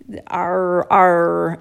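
held, hard American R; harsh English R. Shown as a demonstration. Guest showing the interviewer an English speaker's R, harsh. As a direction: draw out the R harshly. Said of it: "gonna sound very harsh".

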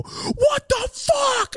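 very high, slow drawn-out shout; shocked friend. Retold or imitated. A friend seeing him spit in his hand, a shocked shout. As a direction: stretch the outrage way up high. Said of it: "and they go".

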